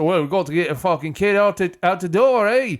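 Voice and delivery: put-on failed Scottish accent sliding into Canadian; Canadian-sounding accent. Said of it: "That's Canadian".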